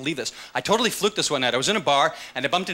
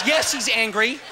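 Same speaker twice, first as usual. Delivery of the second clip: mock shout; 7 semitones higher, slower than usual. Waiter shouting to staff.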